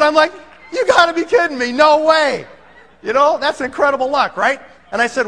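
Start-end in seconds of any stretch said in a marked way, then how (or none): 0.7-2.5 high, loud incredulous exclamation; amazed disbelief. Retold or imitated. Salesman hearing client already uses product, acted disbelief.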